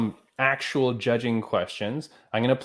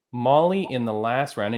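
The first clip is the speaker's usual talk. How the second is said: ordinary delivery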